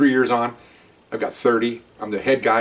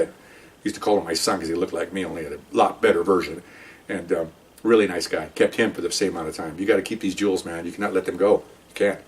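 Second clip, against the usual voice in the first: plain reading voice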